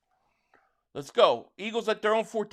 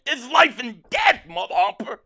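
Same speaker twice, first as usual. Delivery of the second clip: louder and higher, punching "life"; emphatic declaration; 3 semitones higher, 7 dB louder than usual. Real, not acted. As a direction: drive home how serious it is. Fan insisting football matters.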